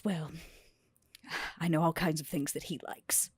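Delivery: ordinary delivery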